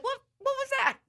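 very high, sudden surprised question; high astonished double take; startled. Retold or imitated. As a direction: jolt up in surprise. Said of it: "I was like".

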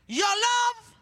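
high, loud YOUR LOVE; preacher belts the phrase; called shout. Shown as a demonstration. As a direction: call it out big to the church. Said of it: "Lift your voice and shout".

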